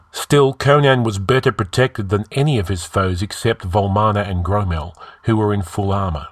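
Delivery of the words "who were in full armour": ordinary delivery, nothing marked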